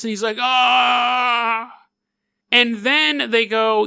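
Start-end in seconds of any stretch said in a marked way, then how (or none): 0.4-1.7 long, high held wail of pretend pain; mock yell of someone being burned; mock agonized scream. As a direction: sustain an acted "aah" on one note. Acting king burned by hot crown.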